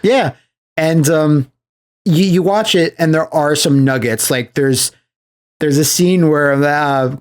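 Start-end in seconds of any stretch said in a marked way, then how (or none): none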